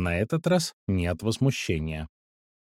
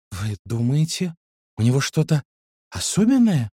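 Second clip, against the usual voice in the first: cautious question; 3 semitones higher than usual. A narrator's reading. Ксюша asks the vet about the dog, cautiously. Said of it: "осторожно уточнила она".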